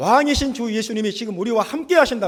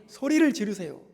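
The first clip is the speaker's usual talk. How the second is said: normal talking voice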